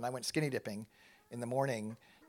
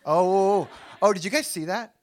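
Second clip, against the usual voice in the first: loud, high laughing surprise; surprised outburst; 5 semitones higher, 10 dB louder than usual. Real, not acted. Pastor spotting a listener's reaction, loud laughing surprise. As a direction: burst out at what you just saw. Sees someone react in audience.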